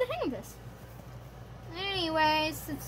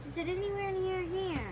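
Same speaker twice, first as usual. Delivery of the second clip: normal talking voice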